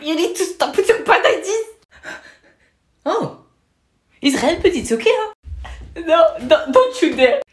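very high, loud squeaky cartoon voice; a small creature scolding a friend; squeaky cartoon character.

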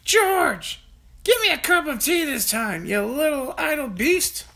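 put-on high, loud cranky old woman ordering; bossy grandma. A narrator's reading. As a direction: command him like a nasty old hag. Grandma shouting demands from roof.

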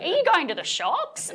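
high-pitched mock Australian accent, lifting at the end; bad Australian accent. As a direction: send up an Australian accent with upward inflection. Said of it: "they always do the kind of upward inflection".

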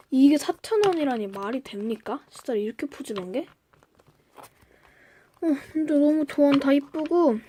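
usual speaking voice

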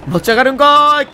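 loud, high mock-surprised retort; comic retort. Real, not acted. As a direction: punch the comeback like a stand-up tsukkomi.

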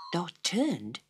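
usual speaking voice

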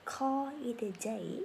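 slow, careful teacher model of the idiom; slow model phrase. Shown as a demonstration. Teacher modelling for students, slow and clear. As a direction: say it slowly for students to repeat.

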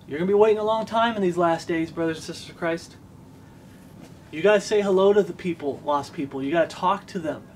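normal talking voice

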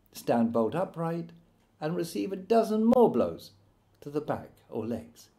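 ordinary delivery, nothing marked

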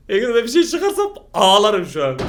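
ordinary talk, nothing marked

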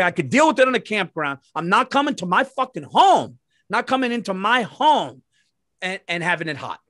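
high, loud angry outburst; retold fury. Man coming home to a hot house, loud high anger. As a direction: let the frustration boil over. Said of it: "now I'm angry".

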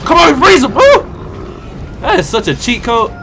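ordinary talk

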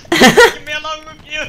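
high, loud panicked yell; real yell. Real, not acted. Yelling at his friend during a scramble. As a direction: shriek it while chaos breaks out. Chaotic shouting while laughing.

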